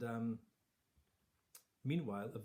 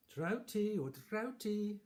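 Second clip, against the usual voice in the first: much higher, slow, drawn-out call; high queen call; 10 semitones higher, 6 dB louder, much slower than usual. Acted. Queen calling down to the fish again, high and drawn out. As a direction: sing the name down into the well.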